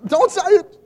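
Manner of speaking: high, loud mock plea; high, loud imitated plea; family begging. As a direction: cut her off, imitated. Family voiced in story.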